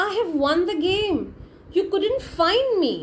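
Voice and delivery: high, gleeful victory cry; excited shout. Retold or imitated. Boy shouting to his friends that he won, high and excited. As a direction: crow about winning the game. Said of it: "And then he shouts. He's so excited".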